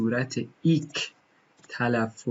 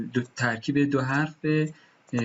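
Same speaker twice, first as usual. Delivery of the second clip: normal talking voice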